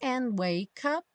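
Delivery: slow "And wake up" joined as one word; three words linked. Shown as a demonstration. Teacher showing three words joined, slow linked "And wake up". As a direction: slide the K of "wake" into "up", drawn out. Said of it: "juntando as três palavras".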